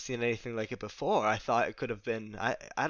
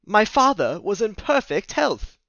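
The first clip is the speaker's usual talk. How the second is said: louder, higher insistent line; firm statement; 8 semitones higher, 10 dB louder than usual. Acted. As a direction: insist firmly against the news. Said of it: "he stated firmly".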